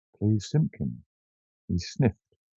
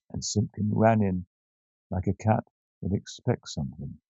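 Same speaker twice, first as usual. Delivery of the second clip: usual speaking voice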